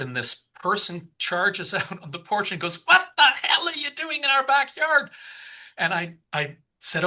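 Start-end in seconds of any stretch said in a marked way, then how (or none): 2.9-5.1 angry homeowner. Retold or imitated. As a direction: shout it as if confronting an intruder. Angry homeowner confronts trespasser, acted.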